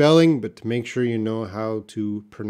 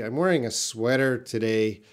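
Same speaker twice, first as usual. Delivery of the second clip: usual speaking voice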